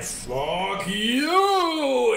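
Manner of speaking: high, pointed "fuck you" tossed off; mocking a comedian with a jab; mock heckle. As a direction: fire it like a catapult shot. Mimics heckling laugh, high.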